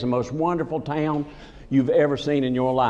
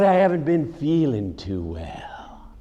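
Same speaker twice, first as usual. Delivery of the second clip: slow, whispery ailing barber; funny-talking barber; slower than usual. Retold or imitated. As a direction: imitate his feeble complaint, trailing into a whisper. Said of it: "But he talked funny".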